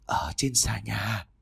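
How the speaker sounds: slow, breathy disembodied whisper; ghostly whisper. A narrator's reading. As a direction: whisper it from nowhere, slowly. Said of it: "có một giọng nói".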